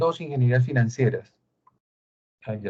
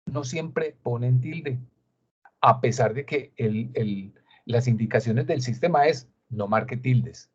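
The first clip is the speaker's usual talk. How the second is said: usual speaking voice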